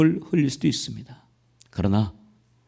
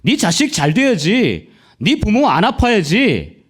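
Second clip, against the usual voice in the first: louder, sneering scoffer's jeer; mocking taunt; 5 semitones higher, 5 dB louder than usual. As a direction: taunt the believer like a mocking neighbour, slowing at the end. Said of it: "하나님을 조롱합니다". (크게 비웃으며 조롱하는 말투)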